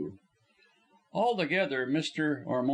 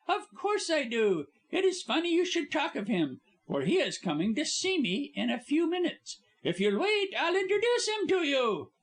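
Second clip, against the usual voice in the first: high, bright stage star voice; lively actress; 13 semitones higher than usual. Acted. Actress Polly babbling to her visitors, high. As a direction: chatter on like a cheerful star.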